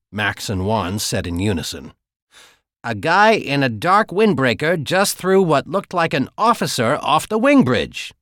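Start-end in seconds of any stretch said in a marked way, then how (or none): 2.8-8.1 urgent report. Acted.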